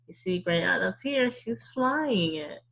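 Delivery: usual speaking voice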